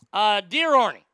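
ordinary talk, nothing marked

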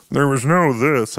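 mock the masked villain, half-hearted; lightly voiced Bane; bad Bane impression. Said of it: "my Bane impression's terrible".

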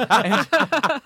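ordinary delivery

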